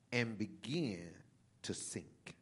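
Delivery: hushed, slow and breathy; whispered narration. A narrator's reading. Telling the church how Peter sank, hushed. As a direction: let the words sink into a whisper.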